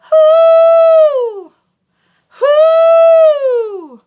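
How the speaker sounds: loud high ringing hoo; full high head tone. Shown as a demonstration. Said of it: "This is head voice".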